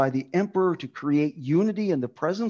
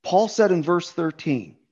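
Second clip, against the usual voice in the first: usual speaking voice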